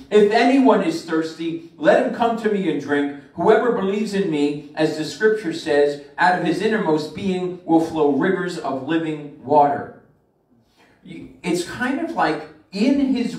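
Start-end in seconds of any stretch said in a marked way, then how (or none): 0.1-10.0 "If anyone is thirsty" called out loud and higher, like a crying out; loud preaching call. Acted. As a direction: proclaim it to the whole crowd at the feast. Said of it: "cried out loudly".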